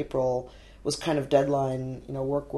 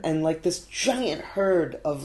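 ordinary delivery

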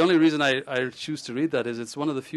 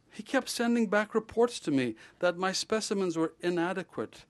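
lively read-aloud of a novel, a little higher; story reading; 4 semitones higher, 4 dB louder than usual. A narrator's reading. Author reading his novel aloud to a crowd, lively storytelling. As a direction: read your novel to the audience with relish.

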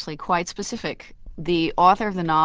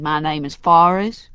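louder, slow Alabama accent where every phrase lifts like a question; Forrest Gump Alabama accent; 6 dB louder than usual. Shown as a demonstration. Dialect coach demonstrates the Forrest Gump voice: slow, louder Alabama accent with upspeak. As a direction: make each phrase a question, in a slow, heavy Point Clear Alabama accent. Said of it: "That pattern of what's called upspeak".

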